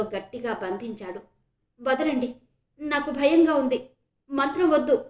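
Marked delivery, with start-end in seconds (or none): 1.8-5.0 trembling plea. A narrator's reading. Frightened woman begging the man to let go, trembling plea. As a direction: narrator lightly voicing a frightened plea, pitched up. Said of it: "అంది వణికే స్వరంతో".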